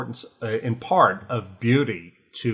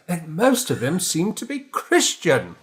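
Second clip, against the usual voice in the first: higher and slow, a hammed-up English accent; Tom Stoppard English accent; 7 semitones higher than usual. Stoppard teasing the conservatives over drinks, mock English accent. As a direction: lift the pitch and stretch the words like a dry English wit. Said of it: "I can't even do his accent".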